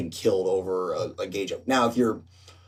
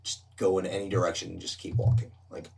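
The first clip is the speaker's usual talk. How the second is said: soft, low-key instructions; hushed, calming bartender talk; discreet reassurance; 4 semitones lower, 8 dB quieter than usual. Retold or imitated. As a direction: usher him out quietly. Bartender discreetly sends man away.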